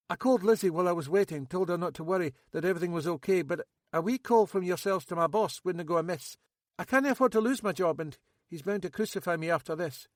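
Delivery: an edgy lad still rattled; nervous young man. Acted. Max pleading.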